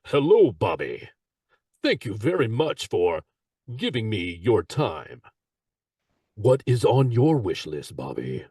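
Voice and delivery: slow, low Santa voice; relaxed Santa Claus voice. Acted. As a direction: slip easily into a calm Santa. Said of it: "when I do my voices".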